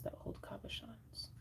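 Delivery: fading into a whisper. Real, not acted. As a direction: drop to a whisper at the end. Trails into whisper.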